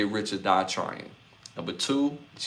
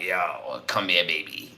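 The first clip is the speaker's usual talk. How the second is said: low, softer mock flirting; lightly put-on seductive voice; mock accented come-on; 3 semitones lower, 4 dB quieter than usual. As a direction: imitate a smooth pickup line. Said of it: "the accent wasn't exactly like".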